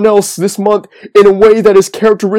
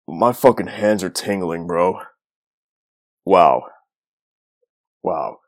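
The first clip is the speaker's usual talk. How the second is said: breathless, soft and spent; catching breath after a long rant; 12 semitones lower, 8 dB quieter than usual. Real, not acted. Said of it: "I'm out of breath right now".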